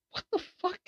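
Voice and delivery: very high, hushed "what the..." of disbelief; stunned disbelief. Retold or imitated. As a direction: squeak it out softly, baffled. Stunned reaction to the scene.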